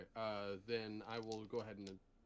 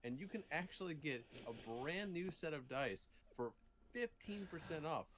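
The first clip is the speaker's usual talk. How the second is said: animated, higher salesman pitch; sponsor ad read; 4 semitones higher than usual. Pretend. Pitching a dice sponsor to an unlucky player, ad read. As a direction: sell it like a live ad spot.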